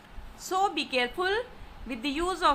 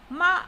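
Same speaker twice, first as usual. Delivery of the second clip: "ma" short and loud; falling tone; 3 semitones higher, 6 dB louder than usual. Shown as a demonstration. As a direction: punch "ma" like a command. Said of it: "It is pronounced using a falling tone".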